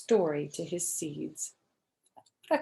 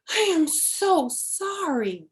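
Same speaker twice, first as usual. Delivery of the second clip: gasped; 10 semitones higher, 5 dB louder, slower than usual. A narrator's reading. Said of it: "gasped Frog".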